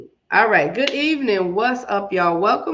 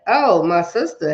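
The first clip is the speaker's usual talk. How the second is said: ordinary delivery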